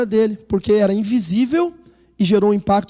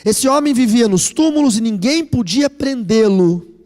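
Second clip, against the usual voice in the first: slow, louder solemn Bible reading; scripture reading; 2 semitones higher, 4 dB louder, slower than usual. Acted. Pastor reading Mark to the church, slow and solemn. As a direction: lay each phrase down slowly for the congregation.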